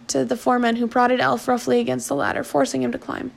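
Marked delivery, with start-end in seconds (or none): none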